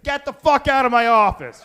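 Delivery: high, loud "get the fuck out"; loud, high mock-furious yell; furious exec. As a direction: throw them out of the office. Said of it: "And the guy's like".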